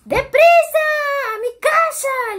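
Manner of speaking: high, loud shout; shouted call. A narrator's reading. Nuf Nuf shouts to his brother to hurry. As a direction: yell for the brother to run. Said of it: "Le gritó Nuf Nuf".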